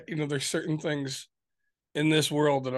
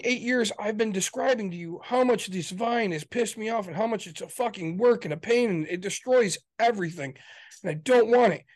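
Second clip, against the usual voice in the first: normal talking voice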